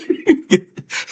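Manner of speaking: high breathy giggle; high laugh. Real, not acted. After teasing the coworker, high giggle. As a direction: giggle at your own prank.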